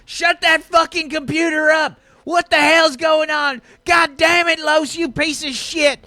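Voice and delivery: high, full-voiced enraged shouting; exasperated angry outburst; furious Lester. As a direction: rage at a broken machine, yelling. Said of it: "Lester's like".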